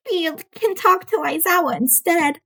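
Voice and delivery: put-on a dry old nurse catching him out. A narrator's reading. Nurse threatens with Aizawa.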